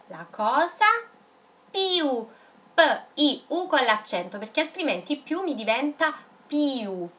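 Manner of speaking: usual speaking voice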